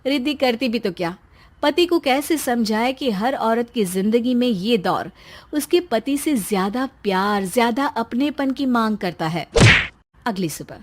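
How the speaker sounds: normal talking voice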